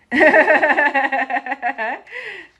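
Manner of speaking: bright burst of real laughter; loud laugh. Real, not acted. Vlogger laughing at her own habit, real laugh.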